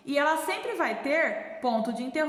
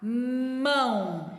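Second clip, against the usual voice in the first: very slow Mamão split as ma-mão; syllable by syllable; much slower than usual. Shown as a demonstration. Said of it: "Eu abri minha boquinha duas vezes".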